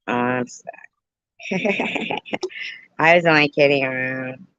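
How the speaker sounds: ordinary talk, nothing marked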